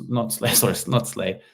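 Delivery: ordinary talk, nothing marked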